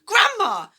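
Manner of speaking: high, loud frightened cry. Acted. George begging Grandma to stop, loud high cry. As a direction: burst out in fear. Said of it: "he cried out".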